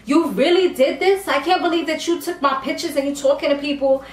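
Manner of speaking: a touch of shocked accusation, quick on the second line; mock outrage at a friend; indignant disbelief. [name] confronting Geraldine, hurt and indignant. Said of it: "she's on some".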